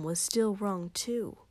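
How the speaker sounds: ordinary delivery, nothing marked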